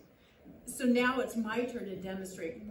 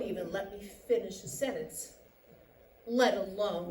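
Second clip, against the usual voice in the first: low-pitched, contained grievance; controlled bitterness; 7 semitones lower than usual. Acted. Wife complaining her husband never lets her finish, controlled bitterness. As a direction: keep it low and held in.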